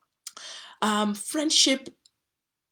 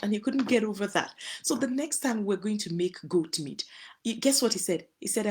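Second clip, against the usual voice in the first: normal talking voice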